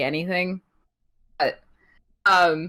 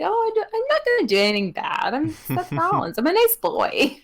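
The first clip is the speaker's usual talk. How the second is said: mock innocent Seth; 10 semitones higher than usual. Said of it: "And he's like".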